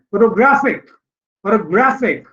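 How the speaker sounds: photographic with GRAPH stressed, pho-to-GRAPH-ic; stress on third syllable. Shown as a demonstration. Teacher modelling word stress for students, photographic stressed on the third syllable. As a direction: hit GRAPH in photographic, then let it fall. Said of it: "the third syllable here has been stressed".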